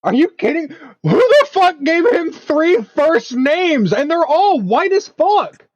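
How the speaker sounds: high, slow, outraged squeal; mock outrage. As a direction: drag it out in shrill disbelief. Mock outrage over three names.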